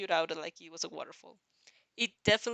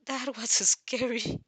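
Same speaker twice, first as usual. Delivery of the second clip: gasped; 3 semitones higher, 5 dB louder, slower than usual. A narrator's reading. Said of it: "Izuku gasped".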